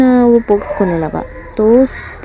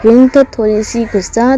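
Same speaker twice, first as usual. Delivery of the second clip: ordinary delivery, nothing marked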